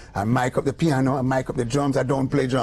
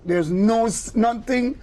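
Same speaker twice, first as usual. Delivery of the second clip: much higher and slow, stretching out the exasperation; exasperated complaint; 8 semitones higher, much slower than usual. Retold or imitated. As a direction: protest in disbelief, dragging the last words. Complaining book has no melody.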